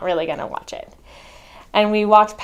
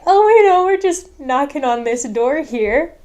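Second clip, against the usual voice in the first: high, loud nervous answer; nervous squeak; 6 semitones higher, 4 dB louder than usual. Retold or imitated. Missionaries answer a man holding a pistol, high nervous squeak. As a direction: stammer it out high, facing a gun.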